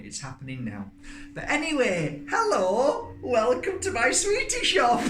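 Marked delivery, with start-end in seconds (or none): 1.3-5.0 high, loud sing-song greeting; excited squeal. Real, not acted. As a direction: squeal the welcome.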